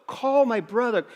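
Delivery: retold yell of a scared kid; mock yell. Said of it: "he's yelling at his other buddy".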